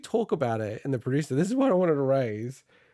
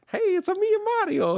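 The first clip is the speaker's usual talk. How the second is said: put-on high, loud Italian accent; Mario; 4 dB louder than usual. Imagining the movie's opening line, in an Italian accent. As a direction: exclaim it in a bouncy Italian accent. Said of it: "a little bit of an Italian accent".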